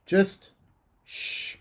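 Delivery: high sharp word, then a hushed shh; exasperated hushing. A narrator's reading. Father silencing his daughter's apology, exasperated hush. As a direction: cut off, then shush them.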